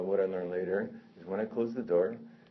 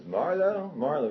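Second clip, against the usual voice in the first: high, loud imitated scream; screaming for someone; 9 semitones higher, 6 dB louder, slower than usual. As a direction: call out "Marlo" like an angry visitor at the door. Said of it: "He starts screaming for Marlo".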